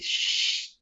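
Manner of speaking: soft voiceless shh of a blade; knife sliding sheath. Acted. Player sheathing knife, voiceless sliding sound. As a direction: hiss like steel into a sheath. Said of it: "slide it back into its sheath".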